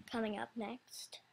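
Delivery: ordinary talk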